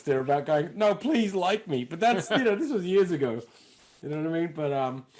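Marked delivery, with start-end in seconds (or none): 0.8-1.8 slow, raised-pitch mock pleading; insecure plea. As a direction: act out a needy plea to be liked. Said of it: "a insecurity, you know, sort of complex".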